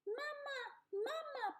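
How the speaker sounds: put-on high, tiny baby bird voice; peeping gosling. A narrator's reading. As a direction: peep it like a little hatchling. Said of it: "peeped the gosling".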